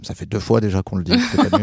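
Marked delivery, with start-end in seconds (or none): 1.3-1.6 high exasperated insistence; fed up, say it for the last time. Retold or imitated. Exasperated repeat. (agacé, insistant)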